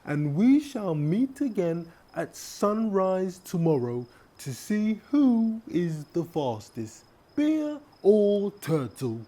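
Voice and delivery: high, slow turtle voice explaining a plan; high, measured turtle voice; scheming turtle. Acted. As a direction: lay out the clever plan. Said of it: "the turtle said".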